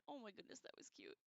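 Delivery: soft whispered coo; hushed aww at a cute pet. Real, not acted. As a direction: whisper over something adorable. Cooing at chickens on cam.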